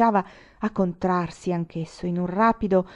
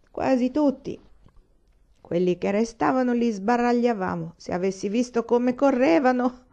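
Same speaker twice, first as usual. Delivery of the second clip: put-on drunk lieutenant bragging; 6 semitones higher, 4 dB louder than usual.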